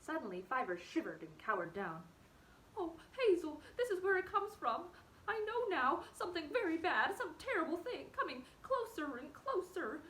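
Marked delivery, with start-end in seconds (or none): 2.8-10.0 high, loud voice on the edge of tears; rising panic, almost sobbing; whimpering with fear. Acted. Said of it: "He began to whimper with fear".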